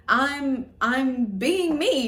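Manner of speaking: mock-defensive comeback, slowed at the end; defensive protest. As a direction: retort defensively, drawing out the last words. Retold argument with her sister.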